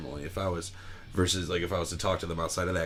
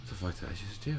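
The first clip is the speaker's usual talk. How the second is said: quiet mock disbelief; 8 dB quieter than usual. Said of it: "And I go".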